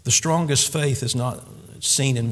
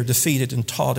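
normal talking voice

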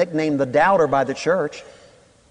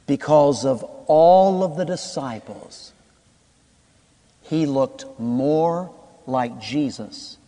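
slow preaching; 3 semitones lower, slower than usual. Acted. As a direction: let the point sink in slowly. Preacher slows for key point.

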